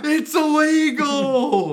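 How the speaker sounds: high, stretched-out gleeful yell; cheering fans. Acted. Imitating fans thrilled by a banned song, wild shouting. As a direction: bellow it slowly, high and loud. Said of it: "all the fans are like".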